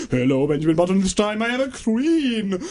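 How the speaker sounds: put-on elf-queen grandeur in a Southern accent; mock Southern accent. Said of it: "she's got a southern accent".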